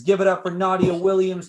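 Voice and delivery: loud winner call-out; host crowning the winner, loud announcer voice; hype announcer. Acted. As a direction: shout the champion's name to the crowd.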